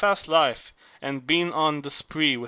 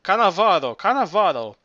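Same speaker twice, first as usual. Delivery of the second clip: a mock parrot squawk, a bit louder; 3 semitones higher, 5 dB louder than usual. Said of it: "that parrot screaming".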